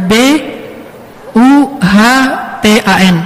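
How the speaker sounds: each letter of buhtan said apart, with pauses; spelling out the word buhtan for the audience; spelled out letter by letter. Shown as a demonstration.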